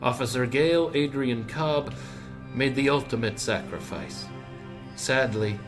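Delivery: usual speaking voice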